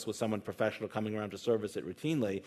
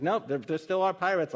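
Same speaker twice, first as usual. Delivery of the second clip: pitched-up, lightly acted insistence; stubborn joke-character rebuttal; insistent contradiction; 8 semitones higher than usual. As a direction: hold your ground, higher in pitch. Joke character insists a second time.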